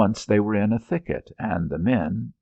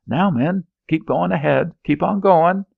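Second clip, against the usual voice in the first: high, loud urging; shouted orders; 10 semitones higher, 6 dB louder than usual. Acted. Officer yelling at his men under fire. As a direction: drive the men on with a yell.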